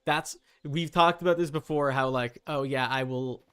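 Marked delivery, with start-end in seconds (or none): none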